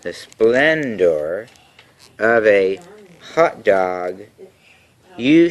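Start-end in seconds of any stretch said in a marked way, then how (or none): 0.0-1.5 slow, savoured "the splendor". Acted. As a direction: draw out "the splendor" slowly, savouring it. Repeats word slowly for effect.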